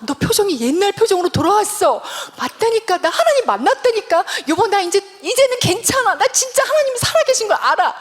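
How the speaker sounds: excited, high-pitched insistence. Acted. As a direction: pitch climbing as you insist you are healed. 남편에게 회복을 알리는 장면. (들뜨고 높은 목소리로 확신에 차서)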